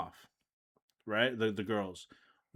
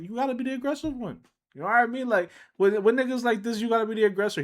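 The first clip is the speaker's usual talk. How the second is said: excited; 10 semitones higher, 3 dB louder, faster than usual. Retold or imitated. Hyping up the girl.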